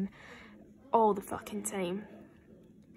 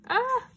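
short, loud, high shriek; sudden sharp cry of alarm; startled yelp; 8 dB louder than usual. Real, not acted. Opener hears a snap in the album, startled yelp.

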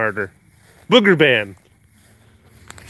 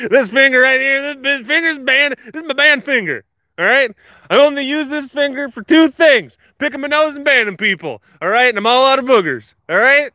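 mock tough guy; 8 semitones higher than usual. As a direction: lightly play the tough enforcer. Mock threatening chat about bans.

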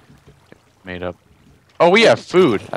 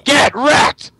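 gloating shout; 11 semitones higher, 3 dB louder than usual. Real, not acted. Gloating in game.

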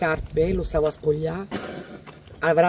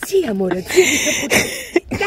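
soothing mother; 9 semitones higher, 8 dB louder, much slower than usual. Retold or imitated. Mother soothing the crying child.

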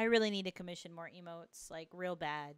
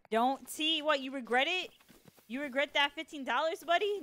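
playful teasing; 6 semitones higher, 6 dB louder than usual. Real, not acted. Teasing a chat member named T.

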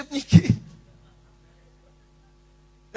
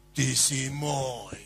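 very slow, much lower and softer, intimate; tender, slow and soft; 8 semitones lower, 5 dB quieter, much slower than usual. Acted. Church members embracing Ondrej, tender and slow. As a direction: say it like holding a brother close.

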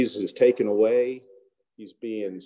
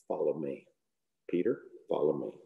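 tender call; 7 semitones lower, 8 dB quieter than usual. Retold or imitated. Jesus calls Peter, gently.

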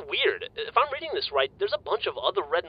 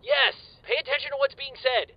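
snaps it out, much higher and impatient; testy outburst; 11 semitones higher than usual. Acted. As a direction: bite his head off for not listening.

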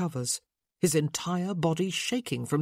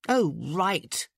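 sarcastic. Acted. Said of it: "with all the sarcasm she could muster".

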